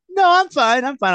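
put-on high, loud kid protest; imitated daughter's denial. Retold nervous daughter denying it.

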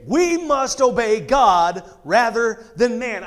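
loud, slow, emphatic declaration; forceful apostle standing firm; strong statement. Acted. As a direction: proclaim it flat out, word by word. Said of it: "flat out makes this strong statement".

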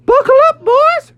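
very high falsetto surfer hero; jolly high-pitched bravado; Daniel Tiger. Acted. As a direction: whoop it up like a cartoon surfer dude. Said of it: "Daniel Tiger said".

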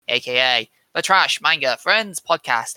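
normal talking voice

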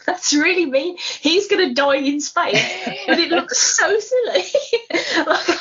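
giggling through the words; laughing. Real, not acted. Laughing at a silly death scene.

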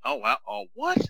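high squeal of shock; surprised shriek. Retold or imitated. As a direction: gasp it out sky-high in disbelief. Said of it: "I was about to say".

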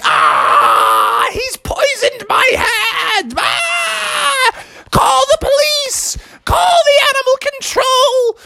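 very high, hammed-up raccoon screeching; panicked wailing. Bitten raccoon begging his friend for help, shrieking.

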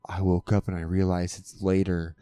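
thinking it to himself half-asleep; quiet inner thought. Retold or imitated. Husband voices his sleepy inner plan, low and soft.

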